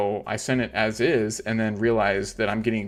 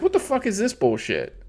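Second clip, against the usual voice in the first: high, loud exasperated yell; exasperated outburst; 11 semitones higher, 4 dB louder than usual. Retold or imitated. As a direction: blurt it out in high outrage. Annoyed at minimum send amount.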